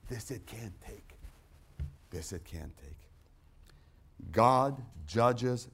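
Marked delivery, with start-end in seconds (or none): 0.1-1.2 quieter still. Acted. Repeated, fading away. 2.1-4.3 a slow hushed whisper to the hall. Acted. Preacher letting the line die to a slow whisper.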